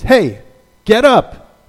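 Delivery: loud sharp wake-up call; angel rousing Peter. Retold or imitated. Angel shaking Peter awake, loud urgent call.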